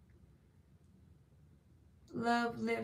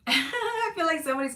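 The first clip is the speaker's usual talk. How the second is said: laughing; 7 semitones higher, 3 dB louder than usual. Real, not acted. Laughs mid reading.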